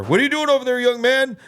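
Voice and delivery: high, loud imitation of police loudspeaker; cop over loudspeaker. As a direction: boom it out like a cop on a speaker. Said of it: "over the loudspeaker".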